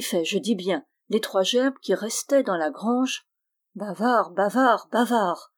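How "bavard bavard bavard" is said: a mild eerie chant, a touch louder in the middle; lightly voiced ghostly repetition; mysterious voice. A narrator's reading. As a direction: taunt him three times from under the arch. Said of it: "prononça par trois fois, sous l'arche, la voix mystérieuse".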